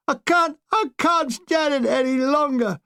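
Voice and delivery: high, slow repeated desperate cry; screaming breakdown. Retold or imitated. Shell-shocked young infantryman, slow desperate scream. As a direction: fall apart, pleading. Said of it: "I suddenly heard a scream".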